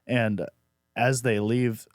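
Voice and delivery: ordinary delivery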